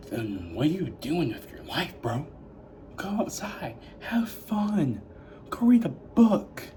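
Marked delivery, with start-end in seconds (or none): 3.0-5.0 hushed, higher teasing advice; a light mock whisper; playful whisper. 5.5-6.6 higher and slower, like a nagging parent; lightly voiced lecturing parent; mock scolding voice.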